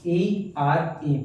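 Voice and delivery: E R E spelled letter by letter, very slowly; slow spelling. Shown as a demonstration. Teacher spelling the ending of there for the class, slow letter by letter. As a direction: draw out each letter of E R E. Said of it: "here में क्या आया".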